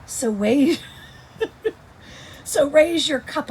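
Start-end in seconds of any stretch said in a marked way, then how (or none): 1.4-3.5 laughing. Real, not acted. Laughs while reading poem.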